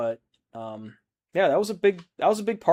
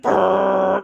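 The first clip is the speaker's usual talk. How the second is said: imitated blaring music; 6 semitones higher, 9 dB louder than usual. Take their thing out and be like.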